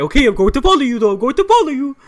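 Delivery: loud, high-pitched mock threat; angry accented man. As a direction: imitate an angry dude yelling a threat. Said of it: "this angry dude".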